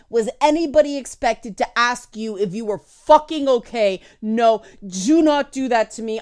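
loud, heated tirade; angry rant. Retold or imitated. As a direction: rant at the coddled colleague with contempt. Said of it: "That's my honest reaction".

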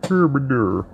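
high, slow hillbilly gibberish; mock hillbilly. Host mocks the man on phone, mock hillbilly.

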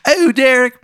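high, loud, hammed-up Maryland accent; cartoonish Maryland caller, high and pushy; bad Maryland accent. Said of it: "in that awful Maryland accent".

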